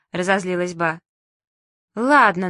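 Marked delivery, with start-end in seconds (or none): 2.0-2.5 loud, stubborn comeback; loud retort. A narrator's reading. Girl teasing grandma, loud retort. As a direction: blurt it louder. Manya insists loudly.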